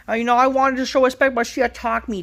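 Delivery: high, loud mocking whine of a self-important wrestler; mocking Becky Lynch. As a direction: ham up her boastful promo in a high voice. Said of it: "Becky Lynch comes out how".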